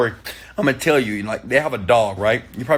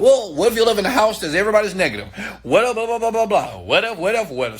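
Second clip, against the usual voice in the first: high, rapid mocking babble; mock excuse-making; 7 semitones higher, faster than usual. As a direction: parody a whiny objector, pitched up and rattled off. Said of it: "everybody's like".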